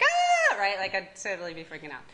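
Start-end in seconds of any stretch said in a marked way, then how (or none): 0.0-0.6 high, loud laughing mock scream of panic. Lecturer acting out waking up paralyzed, mock panic scream. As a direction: shriek like you woke up paralyzed. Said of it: "I'd be like".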